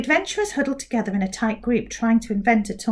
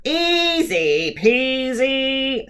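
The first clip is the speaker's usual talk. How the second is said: smug drawl; 3 semitones higher, 3 dB louder, much slower than usual. Acted. Mouth mocks.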